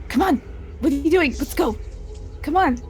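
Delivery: high-pitched, exasperated coaxing; impatient urging. Acted. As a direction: urge a stalled friend to follow. Urging friend to follow through window.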